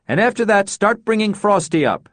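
loud, high commanding bark; barked like an admiral. Acted. Nora orders Luther to haul Frosty, barked. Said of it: "she barked like an admiral".

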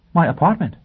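high, startled question; frightened. Acted. Teacher hearing Foyle will come to her apartment, frightened. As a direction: jump in pitch with alarm. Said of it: "Robin was genuinely frightened".

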